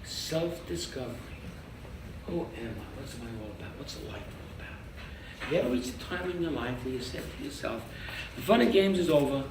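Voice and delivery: ordinary talk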